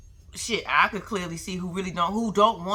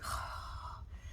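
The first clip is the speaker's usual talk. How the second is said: dreamy swoon sigh; 17 dB quieter than usual. Retold or imitated. Swooning when wooed.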